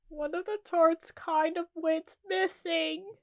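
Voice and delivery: slow, high, careful confession. Acted. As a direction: repeat it slowly in a high put-on voice. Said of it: "but slower this time".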